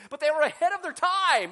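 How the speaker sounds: loud rising preacher's call; emphatic shout. Real, not acted. Preacher shouting to the church about cartoons. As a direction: punch it out to the congregation.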